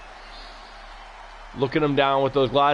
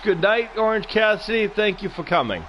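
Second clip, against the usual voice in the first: mocking send-off; 7 semitones higher than usual. Mocking farewell call, shout.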